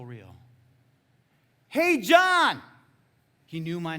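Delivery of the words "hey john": high, full-voiced re-enacted yell; yelled call. Boy on the rock calling to the preacher, yelling. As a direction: yell down from a height to someone far below. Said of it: "He yelled it".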